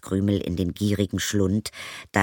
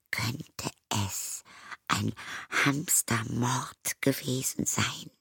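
soft, slow whisper of suspicion; whispered wondering; 4 semitones higher, 6 dB quieter, slower than usual. Acted. Zoe whispers her murder suspicion to herself. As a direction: breathe the question, half to herself.

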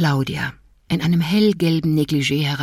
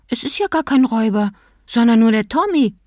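put-on high, quick child's voice; disappointed little boy; 6 semitones higher than usual. A narrator's reading. Little boy telling parents the intruder is only Tommy, high child voice. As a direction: sound like a sleepy disappointed kid.

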